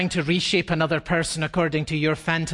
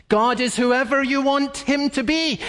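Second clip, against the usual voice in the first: higher, lightly mocking quote; mocking the culture's claim; 9 semitones higher than usual. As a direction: sneer at the slogan. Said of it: "culture is saying to us".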